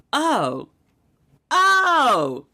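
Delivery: rising, breaking cries of oh; retold crying. Seeing the new name on her passport, a breaking cry. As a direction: voice cracks up high with emotion. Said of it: "sat and cried".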